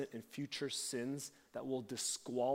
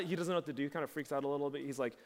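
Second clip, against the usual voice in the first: ordinary delivery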